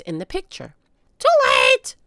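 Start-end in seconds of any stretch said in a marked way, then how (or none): 1.2-2.0 high, loud squawking bird voice; parrot squawk. A narrator's reading. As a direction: screech it like a parrot. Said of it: "the parrot squawked again".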